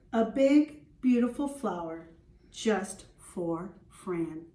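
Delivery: put-on lively read-aloud for young children; storybook reading. Teacher reading to the class, storybook voice. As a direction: read it like a picture book to the class.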